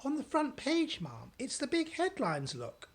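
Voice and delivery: anxious maid showing the paper. Acted. Maid voice, higher.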